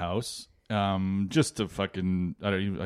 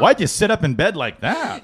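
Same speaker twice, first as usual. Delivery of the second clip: higher, louder, laughing nag; mock nagging wife; 9 semitones higher, 6 dB louder than usual. Husband imitating wife's constant nagging, laughing mockery. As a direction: mimic the wife's nitpick while laughing. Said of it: "she'd be like".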